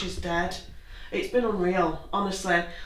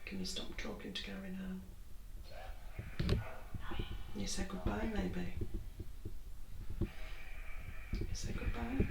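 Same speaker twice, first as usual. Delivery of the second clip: whispering; 15 dB quieter, slower than usual. Real, not acted. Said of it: "I've been really whispering".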